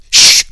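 sharp, loud "shh"; hissed shush. A narrator's reading. Brother shushes his sister in hiding, hissing loudly. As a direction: hiss them into silence. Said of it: "Stacy hissed".

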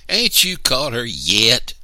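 high, loud, slow annoyed demand; a little brother demanding, annoyed at the slow chaser. A narrator's reading. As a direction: demand an answer, irritated. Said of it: "Little Man demanded".

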